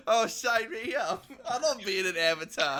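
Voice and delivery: loud, laughing Cockney impression; Michael Caine. Old star accepting a tweeted script, in mock Cockney. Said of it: "And he's just like".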